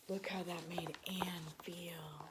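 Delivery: whispered with a little gasp first; hushed whisper. Real, not acted. Reader showing the child Anne's hurt, gasping whisper. Reader reacts to sad picture.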